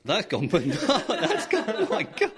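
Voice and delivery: bursting into high laughter; cracking up mid-sentence; laughing. Real, not acted. Breathalyzer breaks, host laughs.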